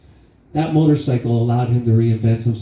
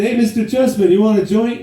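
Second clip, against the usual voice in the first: high, louder call across the table; mock friend's call; 11 semitones higher, 4 dB louder than usual. Friends at the party offering dad a joint, high loud call. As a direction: call out cheekily, much higher.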